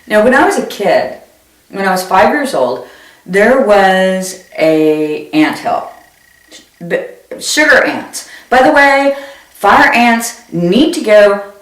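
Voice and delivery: ordinary talk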